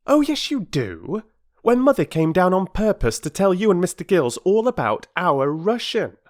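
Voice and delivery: put-on high, loud insisting boy; insistent boy. Peter to Perks, insisting. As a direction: correct him firmly.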